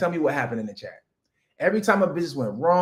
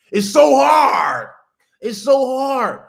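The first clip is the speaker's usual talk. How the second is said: high, loud, slow mock lament; mock whining shout; 7 semitones higher, 9 dB louder, much slower than usual. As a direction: ham up the complaint. Mocking complaint, repeated.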